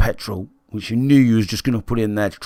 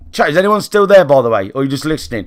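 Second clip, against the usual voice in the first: higher and louder, checking in; calling out to chat; 4 semitones higher, 6 dB louder, faster than usual. Real, not acted. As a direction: raise the voice to wake up the chat. Calls out to silent stream chat.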